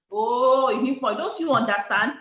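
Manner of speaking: loud, high, indignant protest; exasperated refusal. Retold or imitated. Professional refusing her friend's network marketing pitch, exasperated. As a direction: push them away with emphatic indignation.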